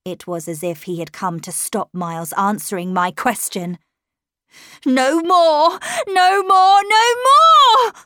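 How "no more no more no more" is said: loud shriek climbing higher with each cry; horrified shriek. A narrator's reading. Governess shrieking at the horror at the window, desperate. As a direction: shriek it at the horror, building to a peak. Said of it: "I shrieked at the horror".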